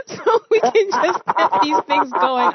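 high laughing voice, slowed; words squeezed through laughter. Real, not acted. Host laughing while talking.

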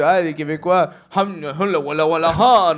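mock foreign babble, bursting out at the end; imitation moqueuse. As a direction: gibberish impression of a foreign language. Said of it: "j'arrivais au Québec, je dis". (baragouin moqueur)

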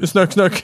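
"snuck, snuck" said with ö vowels; ö-language demo. Shown as a demonstration. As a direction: repeat "snuck" with ö. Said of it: "Eller ö, snuck".

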